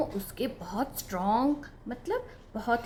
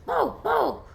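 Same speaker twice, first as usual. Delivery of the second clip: dog bark; 7 semitones higher, slower than usual. Acted. Dog barks.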